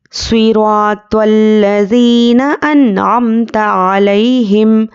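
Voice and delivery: slow, measured recital with long held vowels; Quran recitation. Shown as a demonstration. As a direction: recite as a model, stretching each long vowel. Teacher recites the verse as model.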